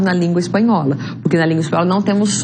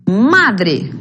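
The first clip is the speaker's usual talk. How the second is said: "Madre" with strong first syllable Ma, falling after; stress on MA. Shown as a demonstration. Teacher showing students the tonic syllable, stressing Ma in "Madre". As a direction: punch the Ma of "Madre". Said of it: "La sílaba tónica es ma".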